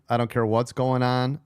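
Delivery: ordinary delivery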